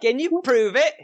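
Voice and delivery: high-pitched challenge; crabby old lady. Acted. Gatekeeper doubting the travellers' claim, crabby high voice. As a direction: challenge them in a high character voice. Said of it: "this crabby old lady".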